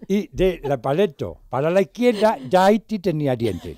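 lightly imitating a half-toothless friend; mock lisp through missing teeth. Friend with no front teeth, a light mock lisp. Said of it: "Y hablaba así".

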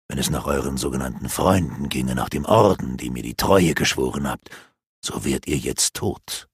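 dark elf mocking the captured apprentices; gloating dark elf. A narrator's reading. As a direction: taunt them with malice.

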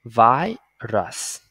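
"Virus" split slowly, long first syllable, schwa in the second; unstressed vowel as schwa. Shown as a demonstration. Teacher models the English word syllable by syllable, schwa pronunciation. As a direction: say "Virus" as VAI, then a light rəs. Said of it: "Nguyên âm U không được nhấn chuyển về ơ".

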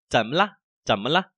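怎么了 run together briskly; 怎么了 in one quick breath, last syllable short; quick normal reading. Shown as a demonstration. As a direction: say 怎么了 quickly, as in everyday speech. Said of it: "nó nhanh này".

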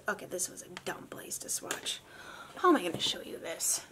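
soft unvoiced grumble at herself; whispered self-scold. Real, not acted. Vlogger scolding herself for a bad swatch spot, whispered. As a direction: whisper it, annoyed at yourself.

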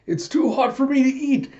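very high cartoon protest; hammed-up, squealed complaint; mock frustrated whine. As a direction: act out a fussy cat's outburst. Acted cat protesting hot food.

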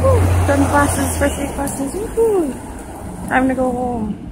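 loud happy relief, a cheer at the end; excited cheer. Real, not acted. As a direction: celebrate being done. Relief after first day.

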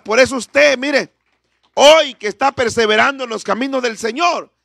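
forceful exhortation, key words stretched out; impassioned preaching. Real, not acted. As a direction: proclaim it to the congregation at full force.

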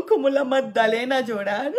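loud laughing, half crying. Real, not acted. Telling how she went off to cry, laughing loudly. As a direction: laugh through the words loudly.

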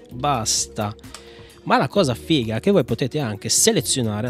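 ordinary talk, nothing marked

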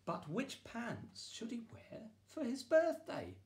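high, curious wondering. A narrator's reading. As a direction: ask it with wide-eyed wonder. Narrator builds suspense.

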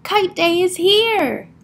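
high, drawn-out storybook call; excited announcement. A narrator's reading. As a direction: announce it to a friend, stretching the words. Bear calls news to Mole.

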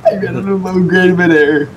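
real alarm shouted out; panicked. Real, not acted. Runs out of fuel midair.

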